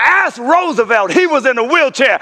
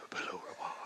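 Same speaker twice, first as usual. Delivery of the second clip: breathy whispered aside; 28 dB quieter, much faster than usual. Acted. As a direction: let it fall to a whisper. Preacher drops to a hush.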